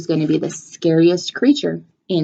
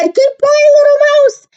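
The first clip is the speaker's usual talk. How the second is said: high, loud frightened cry; panicked goodbye; 6 dB louder than usual. Acted. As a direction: squeal it out while fleeing. Fox flees in panic.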